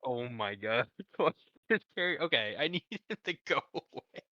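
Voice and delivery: ordinary talk, nothing marked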